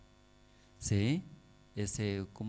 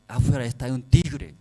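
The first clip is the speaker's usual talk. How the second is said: mother's warning; 3 semitones higher, 7 dB louder than usual. Retold or imitated. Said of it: "esa madre".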